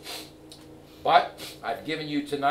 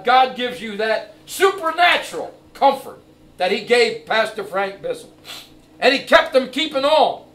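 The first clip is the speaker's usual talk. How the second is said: preaching at full force; 8 semitones higher, 10 dB louder than usual. Acted. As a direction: preach it out to the whole church.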